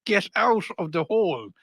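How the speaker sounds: mock nagging Irish wife; calling wife. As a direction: call them out of the hole. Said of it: "their buddy or wives showed up".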